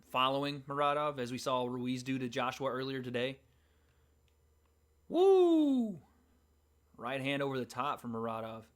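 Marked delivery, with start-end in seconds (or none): 5.1-6.0 excited whoop. Real, not acted. Streamer reacting to a big punch.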